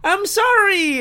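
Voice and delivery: very high, squealed fake apology; mock apology. Podcaster pretends to answer his accuser, squealing mock apology. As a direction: squeal a panicky pretend sorry. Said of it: "And I'll be like".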